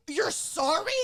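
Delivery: high, indignant echo question; incredulous echo. Retold or imitated. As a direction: throw it back in disbelief. Throwing mom's apology back at her.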